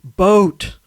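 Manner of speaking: loud, isolated word in a patient's voice; labored single words. Retold or imitated. Hospital patient trying to name a clue, labored. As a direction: force out each word alone, acted. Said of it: "isn't speaking in full sentences".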